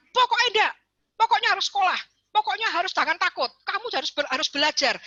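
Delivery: high, insistent, no-arguments parent; bossy parent. Retold or imitated. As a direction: lay down the law to the kid. Dogmatic parent laying down rules.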